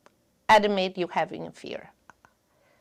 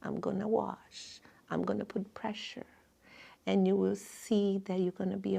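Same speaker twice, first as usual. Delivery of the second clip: calm reassurance; 9 dB quieter than usual. Retold or imitated. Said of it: "I was explaining calmly".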